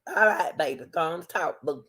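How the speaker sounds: normal talking voice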